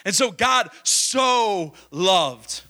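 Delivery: "so" stretched long and slow in So God so loved, the whole phrase at half pace; drawn-out emphasis on so. Shown as a demonstration. Preacher to congregation, dwelling on "so" with slow stretched stress. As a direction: lean hard on "so", drawing it out. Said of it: "That word "so".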